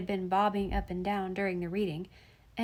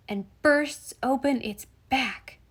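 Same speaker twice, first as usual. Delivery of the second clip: BURSTS punched, with the line drawn out slowly; slow, emphatic reveal, leaning on "bursts"; dramatic climax; 4 semitones higher, 3 dB louder, slower than usual. Acted. Boy reaches climax of his account.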